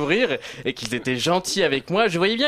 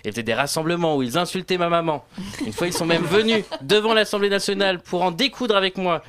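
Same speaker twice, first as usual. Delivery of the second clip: usual speaking voice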